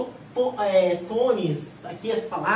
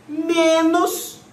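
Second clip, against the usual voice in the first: menos high, loud and drawn out; heavy emphasis; 8 semitones higher, 6 dB louder than usual. Shown as a demonstration. Teacher flagging the exception to the rule, menos loudly stressed. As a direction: hit menos hard as the big exception.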